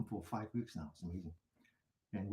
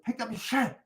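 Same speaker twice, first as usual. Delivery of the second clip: higher, louder neighbour calling across the street; imitated shout; 11 semitones higher, 6 dB louder than usual. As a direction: bark it back as the pointing neighbour. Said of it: "He said".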